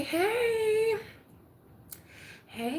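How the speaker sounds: ordinary talk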